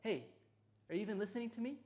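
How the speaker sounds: ordinary delivery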